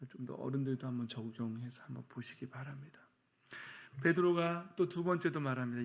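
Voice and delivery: normal talking voice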